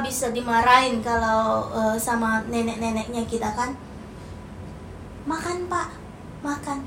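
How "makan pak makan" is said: walk over and invite gently and softly; polite, up close. Shown as a demonstration. Child inviting father to eat up close, soft and polite. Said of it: "harus samperin".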